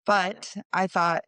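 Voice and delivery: ordinary delivery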